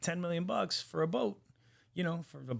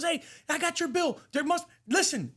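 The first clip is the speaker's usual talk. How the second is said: high-pitched mock outrage; acting out an outraged customer on the phone; going ape shit; 3 dB louder than usual. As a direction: sputter indignantly, voice pitched way up. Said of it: "goes fucking ape shit".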